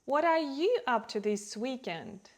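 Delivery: "you" pushed up and out; emphatic "you", falling away after it; stress on YOU. Shown as a demonstration. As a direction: put the weight on "you". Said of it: "Also compare".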